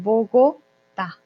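very slow "Bogotá", split as "Bo-go, tá"; drawn-out teacher's "Bo-go-tá"; model pronunciation. Shown as a demonstration. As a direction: break "Bogotá" apart with a clear final "tá". Teacher models the word slowly.

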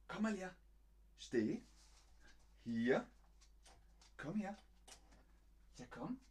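soft, high lilting call; coaxing. Real, not acted. Owner calling Bonnie to come to the camera, gentle coaxing. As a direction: coax a pet over gently.

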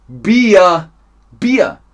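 high, very loud call for beer; shouted order. Pretend. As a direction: shout it across a pretend bar. (laut und hoch gerufene Bestellung)

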